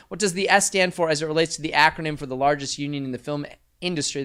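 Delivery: ordinary delivery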